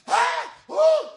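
high shrieked ah and ooh; mock screaming. Disciples' screams during the storm, mock shriek. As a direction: cry out like terrified sailors. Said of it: "They're screaming".

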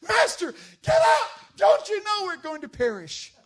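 shrieking high mock panic; panicked disciples. Terrified disciples shriek at sleeping Jesus, hammed-up panic. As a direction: scream it like men about to drown. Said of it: "and they go".

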